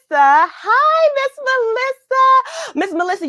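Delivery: high, bright-eyed hello stretched out; light up at seeing her name; excited greeting. Real, not acted. Host happily greeting a returning viewer, excited and high.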